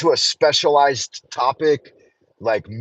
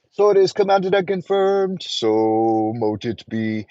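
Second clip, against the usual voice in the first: solemn, drawn-out ritual close; slow incantation ending; ritual closing. Acted. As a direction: seal the spell with gravity.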